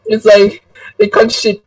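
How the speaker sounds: ordinary talk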